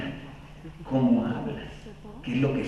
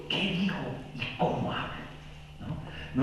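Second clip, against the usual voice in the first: whisper; 12 dB quieter than usual. Shown as a demonstration. Muses aloud in hushed voice.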